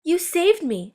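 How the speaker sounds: high, relieved cry of thanks; grateful exclamation. A narrator's reading. Cindy thanking Charlie for rescuing her, high and relieved. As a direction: burst out in relief. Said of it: "exclaimed Cindy".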